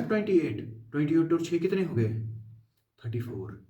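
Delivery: ordinary delivery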